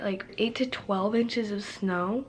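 ordinary talk

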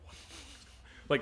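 hushed unvoiced aside; a buddy seeing the scene unfold, in a hushed whisper. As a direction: hiss it under your breath. Buddy Jeff reacting.

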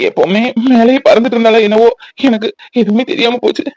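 weepy, choked-up confession; tearful son. Acted. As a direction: apologise to mother through sobs. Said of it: "உன்னை பார்த்தா அவனும் அழுறானா?".

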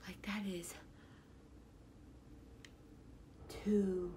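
breathless, shaky whisper, barely voiced; nervous whisper. Real, not acted. Alone in her car watching the test, nervous whisper. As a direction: hush it out as if afraid to jinx it.

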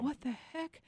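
high, startled question; sudden alarm in the dream; surprised. Retold or imitated.

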